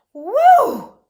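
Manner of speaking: loud high woo of relief; emotional woo. Shown as a demonstration. Teacher shows how tired Mole says it, loud woo. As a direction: let out a big woo. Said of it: "I said it with emotion".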